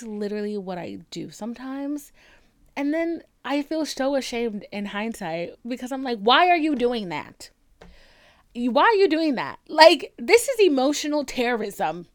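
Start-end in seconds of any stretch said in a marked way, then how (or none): 6.2-12.1 loud, exasperated self-reprimand; indignant, raised-voice telling-off; scolding herself. Acted. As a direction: tell yourself off loudly, slightly raised pitch. Acting out scolding herself in hindsight.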